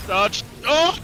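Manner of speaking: sudden yelp as the hit lands; hit hard crying out. Real, not acted. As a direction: cry out when struck. Boss hit during fight.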